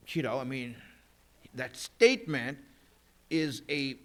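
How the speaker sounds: ordinary talk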